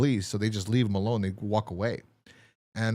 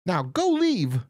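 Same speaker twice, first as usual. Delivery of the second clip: acted old man; 8 semitones higher, 4 dB louder, slower than usual. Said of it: "old man".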